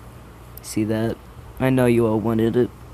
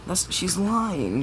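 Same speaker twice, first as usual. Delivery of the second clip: whiny denial; 8 semitones higher, 3 dB quieter, much slower than usual. Retold or imitated. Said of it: "Bakugo is just like".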